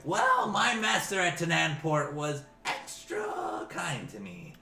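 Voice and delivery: high goblin voice, stretched words; squeaky, ingratiating underling; goblin slave. Acted. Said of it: "He's like".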